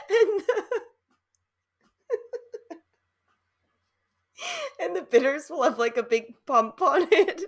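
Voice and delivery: high, breaking up while talking; real helpless laughter. Real, not acted. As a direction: try to get the sentence out through giggles. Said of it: "I don't know why I'm laughing so much".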